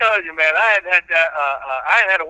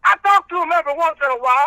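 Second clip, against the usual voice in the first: quick, raised reverend voice; preacher character; 8 semitones higher, faster than usual. Acted. Reverend answering the host about his boys, in character.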